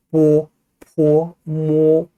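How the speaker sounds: 뽀, 포, 모 drawn out with both lips parting; slow, held demo of 뽀, 포, 모 from the lips; bilabial sounds. Shown as a demonstration. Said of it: "붙었다 떨어지는 양순음".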